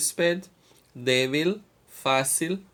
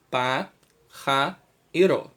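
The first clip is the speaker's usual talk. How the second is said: syllable by syllable; 4 dB louder than usual. Shown as a demonstration. Teacher counting a word's syllables for students, syllable by syllable. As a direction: break the word into "Pa", "ja", "ro". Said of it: "Temos quantas sílabas?".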